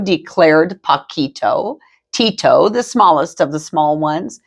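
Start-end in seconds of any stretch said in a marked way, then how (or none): none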